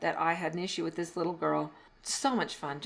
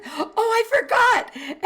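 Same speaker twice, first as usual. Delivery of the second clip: put-on sheepish child; 8 dB louder, slower than usual. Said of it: "He said".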